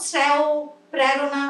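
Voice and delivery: শ্রেয় and প্রেরণা with a clear e; এ ধ্বনিতে শ্রেয় প্রেরণা; e sound. Shown as a demonstration. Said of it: "এগুলো কেউ বলেও না".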